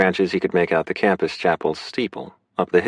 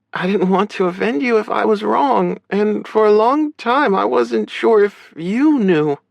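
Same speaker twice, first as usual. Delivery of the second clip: high-pitched sister character voice; hesitant, high voice of an understanding sister; gentle sister; 4 dB louder than usual. Acted. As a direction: play her high, feeling her way carefully.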